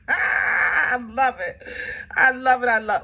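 laughing, then shouting it out loud and high; excited laughing shout. Real, not acted. Reader thrilled by the cards, laughing and shouting with joy. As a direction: burst out with delight, laughing. Excited over new daughters coming in.

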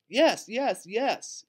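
high, loud chorus of agreement; yelling. A narrator's reading. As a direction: cheer it out like an eager class. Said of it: "yelled the whole class".

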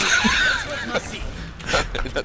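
high shrieking laugh. Real, not acted. Laughs at teammate's complaint. (schrilles Auflachen)

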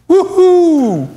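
high, loud cheering whoop; excited whoop. Real, not acted. Preacher cheering Jacob's rebuke, loud high whoop. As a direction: whoop it up for the congregation.

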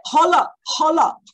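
loud, high-pitched halt; mock alarmed interruption. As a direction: cut in sharply like a parent suddenly alerted. Acting a parent suddenly alarmed, interrupting.

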